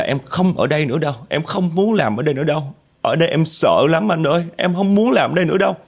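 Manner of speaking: high-pitched, mounting retold plea; imitated panicked cry. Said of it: "Nó la hét xong rồi nó khóc".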